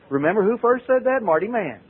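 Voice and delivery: ordinary talk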